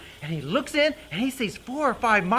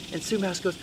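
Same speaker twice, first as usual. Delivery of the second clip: usual speaking voice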